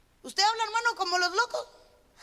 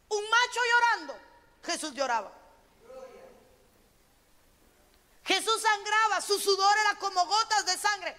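fervent preaching; 6 semitones higher than usual. Acted. Pastor preaching to her congregation about Jesus praying, fervent. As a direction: lift the congregation with rising fervour. Preaching rises to full force.

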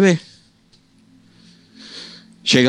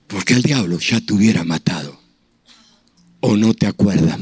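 voice of God; 6 semitones lower than usual. Retold or imitated. The Holy Spirit's voice.

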